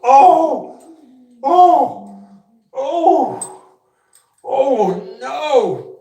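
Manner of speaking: very high, loud drawn-out oh of disgust; real revolted wailing; disgusted groans. Real, not acted. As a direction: groan loudly at the stink. Still reeling from the smell.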